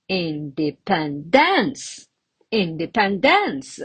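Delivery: independence with final DENCE punched; indepenDENCE, exaggerated end stress; wrong stress. Shown as a demonstration. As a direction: wrongly hit the last syllable of independence. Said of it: "another wrong one".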